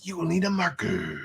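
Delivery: dramatic trailer narrator, trailing off; horror trailer voice. Shown as a demonstration. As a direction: finish like a movie trailer line. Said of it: "trailer voice".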